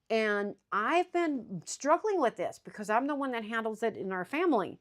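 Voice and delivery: normal talking voice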